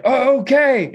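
high-pitched, taken-aback agreement; startled compliance. Retold or imitated. Travelers answer the shouting guard, startled and high. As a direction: go along, mock alarmed.

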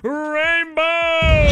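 high, drawn-out mock scream; imitated scream. Host mimics fishing partner celebrating the catch, imitated scream. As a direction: holler it long and high in triumph. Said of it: "by screaming".

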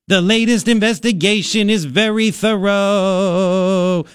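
put-on droning politician quote; mocking quote. Mocking quote of Susan Collins.

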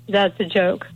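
normal talking voice

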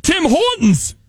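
high-pitched mock Canadian; Canadian accent. Shown as a demonstration.

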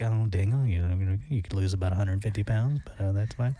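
very low, laid-back doctor drone; too-calm doctor. Acted. Host mocking a doctor giving news to a patient, far too calm. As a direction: drop deep and casual as a mellow doctor. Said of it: "I don't want him coming like".

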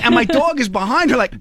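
ordinary talk

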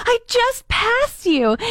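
high, slow and disbelieving; slow, high, baffled protest; incredulous. Retold or imitated. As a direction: spell it out in stunned disbelief. Telling kids they hit her.

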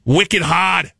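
mock Bostonian; Boston accent. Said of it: "is also Boston".

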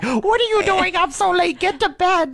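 high, scolding mom voice; scolding mother voice. Retold or imitated. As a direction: scold like a mother up past bedtime. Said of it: "I feel like I'm your mother".

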